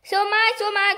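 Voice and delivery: panicked cry; cried. A narrator's reading. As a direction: wail it in panic. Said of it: "cried Mister Twit".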